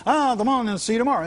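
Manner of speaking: mock cheerful parting; breezy farewell. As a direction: wave off cheerfully. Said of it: "I go".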